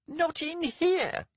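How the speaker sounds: very high, loud, drawn-out screech. A narrator's reading. Landlady stops two men brawling in her bar, screeching. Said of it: "screeched the landlady".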